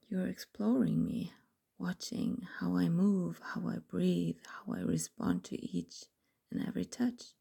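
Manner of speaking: breathy intimate whispering; whispered story. A narrator's reading. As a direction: whisper the story close to the mic. Whispered erotic story throughout.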